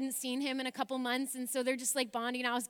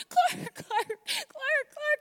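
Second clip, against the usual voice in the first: loud, much higher, frantic repeated calling; screaming his name; 6 dB louder, much slower than usual. Retold or imitated. Screaming her husband's name in a panic from the balcony. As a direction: scream the name over and over, half crying. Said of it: "screaming his name".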